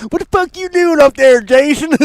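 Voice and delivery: high, loud mock of the trailer line; high mocking parody; mocking imitation. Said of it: "quoting trailer line".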